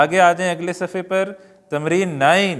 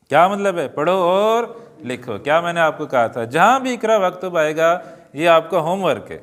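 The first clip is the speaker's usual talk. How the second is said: ordinary delivery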